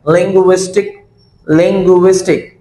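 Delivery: "Linguistic" measured, each of ling-gwis-tik sounded out; syllable by syllable. Shown as a demonstration. Teacher reading the split word to students, "linguistic" syllable by syllable. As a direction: sound out "linguistic" carefully in three parts. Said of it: "इस लफ्ज को पढ़ना है".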